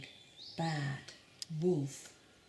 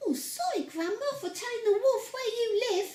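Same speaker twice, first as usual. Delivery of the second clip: put-on much higher, sweet little girl; 13 semitones higher, faster than usual. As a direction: squeak it like a tiny girl. Girl voiced in the story.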